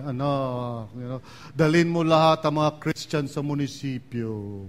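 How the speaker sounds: voice of Jesus. Retold or imitated. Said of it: "di ko alam kung anong tono eh".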